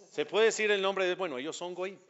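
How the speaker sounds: usual speaking voice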